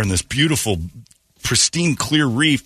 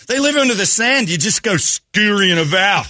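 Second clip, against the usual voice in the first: put-on much higher Australian accent, casual; Aussie guide explaining, pitched way up; 10 semitones higher than usual. Australian guide explaining the ray in a high Australian accent. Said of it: "his accent".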